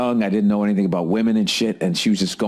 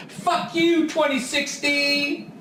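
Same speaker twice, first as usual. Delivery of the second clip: high, drawn-out yell at the year; slow, high comic rant; mock yell; much slower than usual. Shouting at the year.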